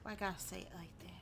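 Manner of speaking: quick, hushed copy of the NPC's sly tone; mocking the NPC. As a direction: mimic the creepy offer quietly and fast. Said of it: "echoing the NPC's line".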